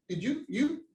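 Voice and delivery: ordinary talk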